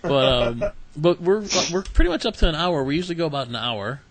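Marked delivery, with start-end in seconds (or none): none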